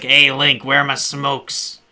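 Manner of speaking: loud, drawn-out smoker Zelda voice; Zelda wanting cigarettes. Acted. Zelda demanding smokes from Link, smoker's voice. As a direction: holler slowly like a smoker losing their voice. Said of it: "I can do it a lot better now because I'm starting to lose my voice".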